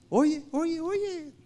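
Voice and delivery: high, urgent call to listen; preacher calling the congregation to listen, high. Real, not acted. Preacher calls listeners to hear.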